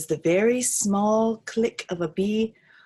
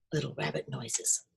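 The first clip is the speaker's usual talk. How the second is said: ordinary delivery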